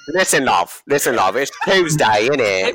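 mock British accent. Answering the British joke in a mock British accent. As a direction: play up a cheeky Londoner.

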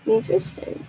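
breathy whispered echo. Pretend. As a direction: whisper it to yourself, amused. Whispered echo of own word.

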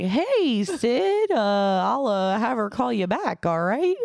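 imitating someone awkwardly covering panic; high, hesitant, pretend-casual phone voice; mock contained panic. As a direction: start high, then stall through the uhs. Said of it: "I don't wanna say that he panicked, but he recognized the level of panic".

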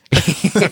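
short high laugh; real laugh. Real, not acted. Amused at the runner's pointless errand, laughing. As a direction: burst out in a quick giggle.

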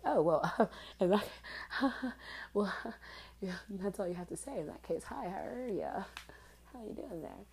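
loud flustered laughter through the words; laughing. Retold or imitated. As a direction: giggle it out, caught off guard. Laughing reply to his flirt.

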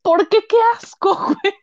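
loud, high laughing outcry; laughing shout. Real, not acted. Indignada riendo. (grito agudo riendo)

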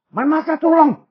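attempted German accent, high-pitched; a clumsy try at a German accent. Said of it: "I can't do a German accent".

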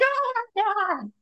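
very high, slow "Oh my God"; starstruck gasp. Retold or imitated. Seeing Cirie from the train window, very high starstruck gasp. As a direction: gasp at the sight of your idol. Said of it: "I was like".

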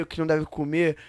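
ordinary delivery

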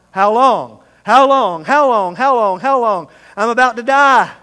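loud repeated pleading cries of "How long"; desperate cry. Acted. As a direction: cry out like a man at the end of his rope. Voicing David's desperate cry.

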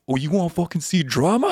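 high, loud threatening snap; furious challenge. Retold or imitated. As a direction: dare her to start something. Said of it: "Tina was like".

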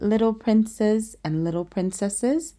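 ordinary delivery

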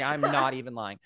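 ordinary talk

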